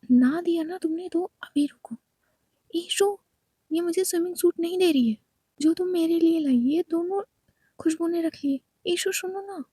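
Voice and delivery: loud, whiny tattling to a friend; shouting complaint. Acted. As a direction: call out to Ishu and complain at the top of her voice. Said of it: "क्यों चिल्ला रही हो?".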